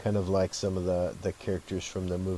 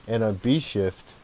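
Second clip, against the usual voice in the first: usual speaking voice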